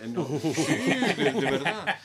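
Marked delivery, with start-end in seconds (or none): 0.3-1.1 a bright, loud burst of real laughter. Real, not acted. Guest bursts out laughing at the host's disbelief. 1.2-2.0 louder words still shaking with real laughter; laughing through words. Real, not acted. Guest answers the host while still laughing.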